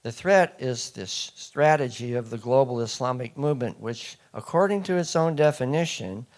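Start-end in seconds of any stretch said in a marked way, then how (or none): none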